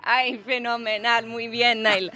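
drawn-out Spanish praise; Spanish accent. Pretend. Host praises singer in Spanish, Spanish accent.

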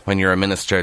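ordinary talk

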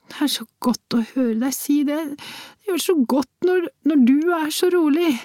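high-pitched tearful woman's voice; sniffling. A narrator's reading. Worried woman thanking him for his calm, sniffling. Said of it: "snufset hun".

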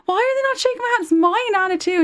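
put-on high, plaintive young girl; a kid tugging at mum's sleeve; whiny child voice. Child self quoted to mum.

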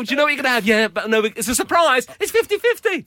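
high, loud smug coyness; mock smug parent. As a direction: act coy about a secret everyone guesses. Mocking parents keeping sex secret.

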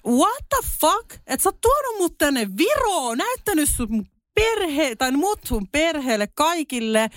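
high, indignant retelling of her fury; furious girlfriend, high and incredulous; retold outrage. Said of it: "mä suutuin tästä ihan sikana".

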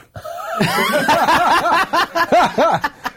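high, long burst of laughter; laughing. Real, not acted. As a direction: crack up laughing. Laughing at the story.

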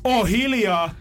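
high-pitched mock yell; retold shout. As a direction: retell your outburst, pitch jumping up. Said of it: "mä huudan".

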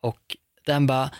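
ordinary delivery

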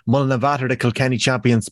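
usual speaking voice

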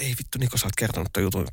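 a quiet inner scolding. Retold or imitated. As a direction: say it under your breath to yourself. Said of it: "alitaunta huutaa".

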